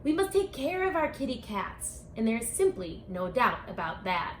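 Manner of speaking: ordinary delivery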